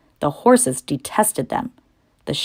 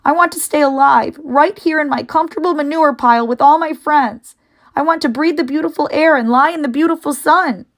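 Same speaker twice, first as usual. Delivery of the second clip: high, loud weeping plea; tearful pleading; 10 semitones higher, 4 dB louder than usual. Acted. Wilbur weeps about wanting to stay alive, high and loud. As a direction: wail for the life you'll lose.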